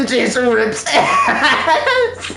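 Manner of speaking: slow, drawn out through giggles; laughing. Real, not acted. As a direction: squeeze the words out while laughing.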